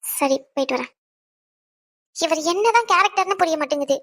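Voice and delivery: ordinary delivery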